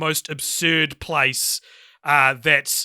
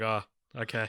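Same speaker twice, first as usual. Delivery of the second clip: low, much softer deflated okay; retelling her muted reaction to grade A; unimpressed mumble; 9 semitones lower, 12 dB quieter than usual. Retold or imitated. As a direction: let it fall flat and small. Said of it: "and I was like".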